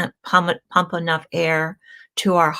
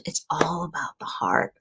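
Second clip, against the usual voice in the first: whisper; 2 semitones lower, 7 dB quieter than usual. Real, not acted. Confiding aside about the heart.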